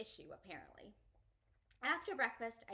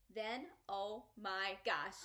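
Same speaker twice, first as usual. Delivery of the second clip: ordinary delivery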